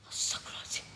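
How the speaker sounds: breathy frightened whisper of a prayer; scared whisper. Real, not acted. In a haunted spot, praying under his breath, whispered. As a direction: whisper it so the ghost won't hear.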